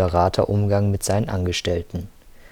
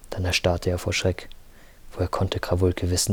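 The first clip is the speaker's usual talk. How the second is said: ordinary delivery, nothing marked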